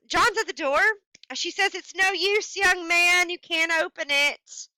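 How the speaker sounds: dramatic story reading. Acted. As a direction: read it like the story's creeping climax. Reads the story's climax aloud.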